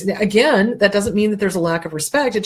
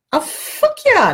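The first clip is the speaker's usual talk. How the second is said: much higher, slow drawn-out yes; high emphatic oh yeah; emphatic agreement; 3 dB louder, slower than usual. Real, not acted. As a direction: stretch it out in strong agreement. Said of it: "emphatic agreement".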